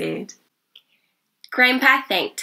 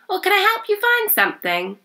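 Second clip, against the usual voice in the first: much higher, bright shop assistant voice; cheery; 13 semitones higher than usual. A narrator's reading. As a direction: greet him cheerfully, high and lively. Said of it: "she asked cheerily".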